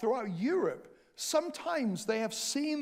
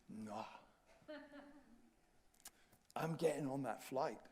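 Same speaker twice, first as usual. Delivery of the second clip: low, soft, steady no; quiet firm refusal; 6 semitones lower, 14 dB quieter than usual. Retold or imitated. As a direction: calmly insist on your right. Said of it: "I said".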